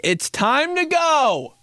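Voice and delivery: high, drawn-out call to leave; impatient call. Retold or imitated. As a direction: sing it out to end the party. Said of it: "I'm like".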